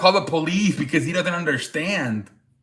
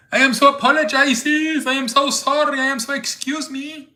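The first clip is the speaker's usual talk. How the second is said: put-on Turkish accent; 11 semitones higher than usual. Imitating the Turkish streamer apologizing to store staff, high mock Turkish accent. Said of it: "so he does an accent".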